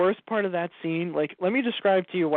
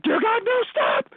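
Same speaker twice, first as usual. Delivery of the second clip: high, loud anguished cry of no; 5 dB louder than usual. Retold or imitated. Reliving watching the episode, shrieking high and loud. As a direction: shriek it like your hero is doomed. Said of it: "and I'm like".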